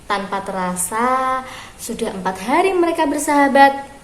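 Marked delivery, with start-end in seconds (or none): none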